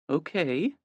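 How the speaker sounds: hesitant. A narrator's reading. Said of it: "hear the hesitancy in that word".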